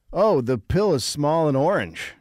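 high, loud, slow corporate pitch; mock pharma exec. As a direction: announce it like a proud drug executive. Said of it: "they'll be like".

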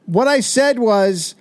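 very high mock yell; exasperated shouting. Man misheard over the crowd refusing a manicure, exasperated shouting.

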